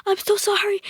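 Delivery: very high, pleading sorry; way-up-high begging apology; frantic apology. Retold or imitated. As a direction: squeal it out as a panicked boy. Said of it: "he goes".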